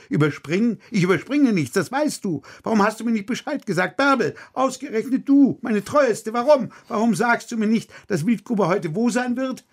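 high, shrill-pitched furious outburst; tantrum. Acted. Director in a tantrum at his dramaturge, furious high outburst. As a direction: explode at her, pitch climbing. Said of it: "bekam einen Tobsuchtsanfall".

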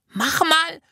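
put-on very high little-girl voice; urging child. A narrator's reading. Jana pushing the narrator to open the parcels, urging. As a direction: press them to do it, high and quick.

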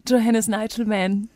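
drawn-out, dramatic plea; slow pleading. Acted. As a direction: stretch every word, begging. Mock farewell plea.

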